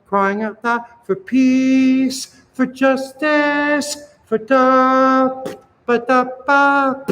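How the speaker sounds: high, slow chant, long S ending "peace" and "justice"; rhythmic consonants. Shown as a demonstration. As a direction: intone it high, popping "ba, da, ba" in rhythm. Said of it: "Consonants are rhythmic".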